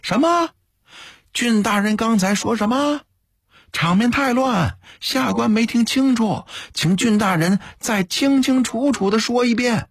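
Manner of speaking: high-pitched mock incomprehension; feigned puzzlement. A narrator's reading. Sly official pretending not to have heard, feigned puzzlement. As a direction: call down as if you truly missed it. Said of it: "刘不周假装狐疑地说道".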